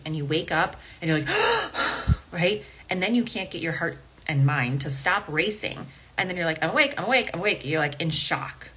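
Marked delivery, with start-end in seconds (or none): 1.3-2.3 sudden panicked gasp; sharp gasp. Acted. Waking from sleep in panic. 6.6-7.6 breathless alarmed repeating; panicked. Acted. Acted waking in shock.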